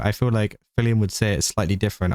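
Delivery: ordinary talk, nothing marked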